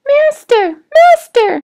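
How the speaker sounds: very high, loud cry of a magic harp. A narrator's reading. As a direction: shriek for help like a frightened magic harp. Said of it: "the harp cried".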